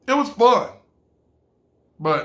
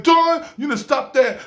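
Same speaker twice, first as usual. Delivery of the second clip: put-on a rough New York accent, higher and louder; an amateur New York accent telling him off; 9 semitones higher, 5 dB louder than usual. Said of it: "my New York accent is not that good".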